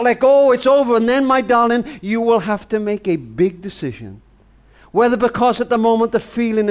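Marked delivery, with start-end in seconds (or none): 0.0-1.0 higher and louder, a mock inner scream. Grandfather to granddaughter, imagined inner voice screaming to give up. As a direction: cry it out like a voice insisting you quit. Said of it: "everything will scream out".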